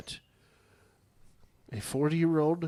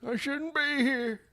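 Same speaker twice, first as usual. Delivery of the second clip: movie line quote; 13 semitones higher than usual. Retold or imitated. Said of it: "right out of Southern Comfort".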